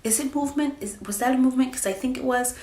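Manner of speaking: an eager, hopeful half-doubt, lightly acted; excited wondering. Expectant mom imitating herself wondering about flutters, eager questioning. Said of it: "but it's like".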